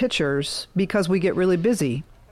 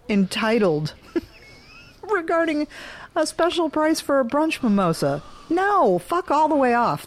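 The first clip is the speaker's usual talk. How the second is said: higher-pitched, exasperated outrage with a laugh; comic indignation, pitched up; indignant rant; 6 semitones higher than usual. Real, not acted. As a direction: vent in disbelief at the nerve of it. Ranting at the entitled customer.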